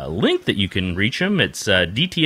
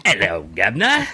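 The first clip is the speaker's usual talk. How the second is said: Cockney chimney sweep. Acted. Co-host mocks Chris with a hammed-up Cockney greeting. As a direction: play a Mary Poppins Cockney sweep. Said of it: "came straight out of Mary Poppins".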